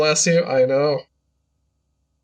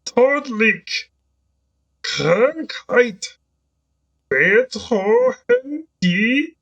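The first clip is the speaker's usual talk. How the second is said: dramatic trailer voice; 6 semitones higher, much slower than usual. Pretend. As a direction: build the doom like an epic trailer narrator. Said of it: "You don't have to do that with your voice".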